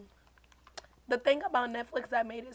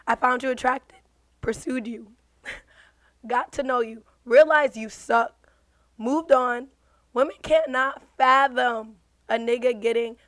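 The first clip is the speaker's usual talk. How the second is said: louder, slower, mocking drama; toxic dramatic reading; 9 dB louder than usual. Shown as a demonstration. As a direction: read it like a toxic guy bragging. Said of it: "this is how I read it in my head".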